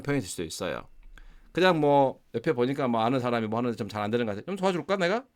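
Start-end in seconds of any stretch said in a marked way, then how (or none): 4.5-5.2 light, tossed-off offer, pitched higher; casual offer. Shown as a demonstration. Teacher casually offering help to an acquaintance, light and higher. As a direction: toss the offer out lightly to someone struggling. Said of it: "이렇게 가볍게 말을 던지는 말".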